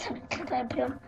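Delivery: whisper. Real, not acted. Sneaking past a monster in game.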